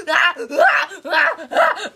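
loud panicked ah ah screams; screaming in pain. Acted. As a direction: cry out as if hurt. Acted screaming during attack.

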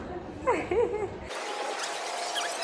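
high breathy laugh. Real, not acted. Laughing at the cat.

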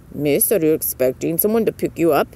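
low, polite query from a railway official; station captain. A narrator's reading. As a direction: put on a low character voice for the captain. Station captain questions the girls.

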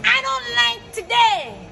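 slow, high, drawn-out whine; whiny catchphrase. Acted. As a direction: drag it out like a sulking kid.

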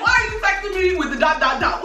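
laughing, high-pitched mock disbelief; mock outrage. Real, not acted. Daughter teasing dad over his dotted text, high laughing disbelief. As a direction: tease the dots with a squeaky laugh.

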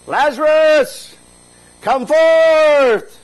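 huge, high, drawn-out shout; booming summons held long and high; loud cry. Acted. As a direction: call out at full volume, stretching each word. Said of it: "he cried out in a loud voice".

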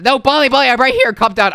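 pleading, urgent call; urgent reassurance. Acted. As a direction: call out to stop a panic. Calling to panicking friend.

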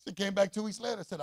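usual speaking voice

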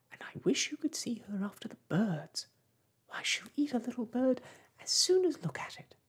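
gushing about her beloved pet; eager. A narrator's reading. Alice eagerly telling the party about her cat, rising with excitement. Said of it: "replied eagerly".

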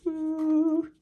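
high whooping cheer. Real, not acted. Celebrating a gold card pull for viewers, high whoop. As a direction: let out a whoop of joy.

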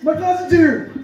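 high-pitched excited announcing to everyone; imitating a thrilled, pumped cousin. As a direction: act out an overjoyed inmate telling the whole jail. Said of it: "he's excited. He's, like, so pumped".

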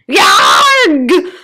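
loud, high creature shriek; agonised monster cry; blood-curdling screech. A narrator's reading. Sock writhing on the floor, blood-curdling screech. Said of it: "blood-curdling sound".

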